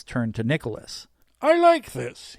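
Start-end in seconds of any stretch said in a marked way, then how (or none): 1.4-2.4 high, loud, hammed-up approval; Santa act. A narrator's reading. Santa sees the first rocket, theatrically pleased. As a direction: exclaim in a piping Santa voice.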